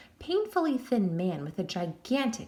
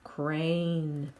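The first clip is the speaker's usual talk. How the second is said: deep moan; 4 semitones lower than usual. A narrator's reading. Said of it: "moaned in the deepest voice".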